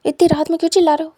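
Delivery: loud, fast scolding yell; angry shout. Acted. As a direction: snap at him for shouting. Teacher scolds student yelling at night. (गुस्से में ज़ोर से डांटना)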